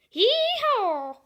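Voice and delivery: high, loud Southern hee-haw; southern accent. Pretend. Kid showing off his Southern accent, loud hee-haw. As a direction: belt it out like a country yokel. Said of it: "That's my Southern accent".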